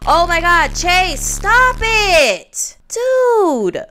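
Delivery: high, loud, drawn-out angry yelling; furious yell. Real, not acted. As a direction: scream at them to stop. Yelling at sims in frustration.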